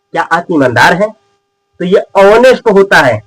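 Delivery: ordinary talk, nothing marked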